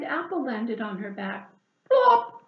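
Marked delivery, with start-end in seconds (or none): 1.9-2.4 loud, high sound word; loud plop. A narrator's reading. Narrator voicing the apple landing, loud high plop. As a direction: pop the word out like a falling apple.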